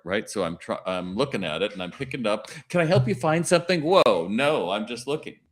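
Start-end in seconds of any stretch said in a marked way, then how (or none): 2.7-3.8 eager salesperson. Retold or imitated. Pushy salesperson approaching browsing shopper.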